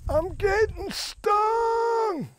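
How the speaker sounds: very high, extremely drawn-out comic wail; owl guy's mock scream. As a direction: reprise the bandmate's wail, stretching every word up high. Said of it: "he leans over and he goes".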